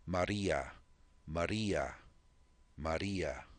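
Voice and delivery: "Maria" with the R tapped once, like a quick D. Shown as a demonstration. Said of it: "the R has a rolled sound".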